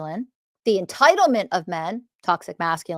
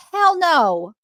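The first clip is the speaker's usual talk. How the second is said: emphatic refusal; 7 semitones higher, 5 dB louder than usual. Retold or imitated. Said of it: "she immediately was like".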